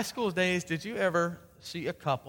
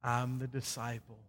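low, soft and reverent, with long pauses; tender, hushed closing; 4 semitones lower, 5 dB quieter than usual. Real, not acted. Pastor sending the congregation off, tender and hushed. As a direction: bring the message down to a gentle close. Sermon closes softly, tenderly.

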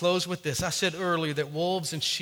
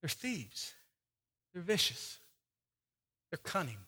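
hushed warning; 3 semitones lower, 12 dB quieter than usual. Acted. Hushed warning about thieves.

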